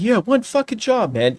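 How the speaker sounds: high-pitched mock sarcastic jab; sarcastic joke. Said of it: "made a sarcastic joke".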